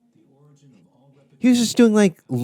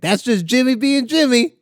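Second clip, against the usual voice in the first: higher put-on voice, singsong; mock comedian voice; 14 semitones higher than usual. Retelling a comic's catchphrase in a high voice. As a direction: sing out the catchphrase. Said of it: "he did this thing where he was like".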